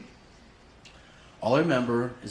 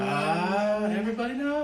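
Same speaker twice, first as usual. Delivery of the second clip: high, laughing dismay; resigned laugh; 8 semitones higher than usual. Retold or imitated. Son seeing his parents arrive, high laughing dismay. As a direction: realise the game is up and laugh at it.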